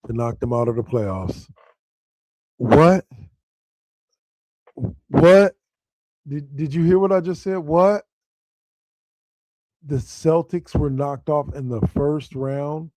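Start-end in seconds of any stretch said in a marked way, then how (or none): 2.5-8.0 high, loud incredulous "What?"; can't believe the news; stunned disbelief. Real, not acted. Host to listeners about the Celtics upset, high and loud disbelief.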